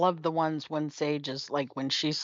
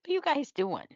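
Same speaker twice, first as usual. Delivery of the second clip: dog voice; 11 semitones higher, faster than usual. Retold or imitated. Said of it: "he'll look at him like".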